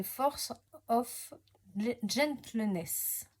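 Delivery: slow, careful English with a French accent. Real, not acted. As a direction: sound out the English name haltingly, French accent. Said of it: "pour mon accent, je parle pas très bien anglais".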